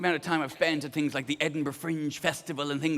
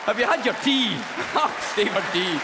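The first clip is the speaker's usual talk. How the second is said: genteel Scottish; 2 semitones higher, 4 dB louder than usual. Retold or imitated. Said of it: "Scottish porn".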